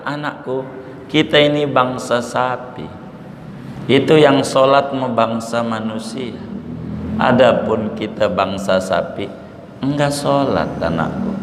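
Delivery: a lightly voiced mother cow speaking tenderly to her calf; gentle, tender. Shown as a demonstration. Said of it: "tapi halus".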